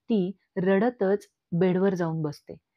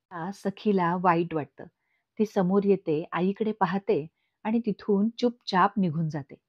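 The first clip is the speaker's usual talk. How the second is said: plain reading voice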